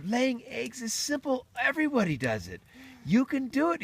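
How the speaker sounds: high-pitched pep talk; encouraging rooster. Acted. Rooster encourages hen-man.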